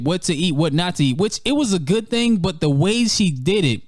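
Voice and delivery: usual speaking voice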